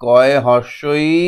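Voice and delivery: slow, drawn-out letter naming; spelling out. Shown as a demonstration. Teacher reads the spelling to pupils, spelled out slowly. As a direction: stretch each letter name as you read. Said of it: "এইটা পড়ি".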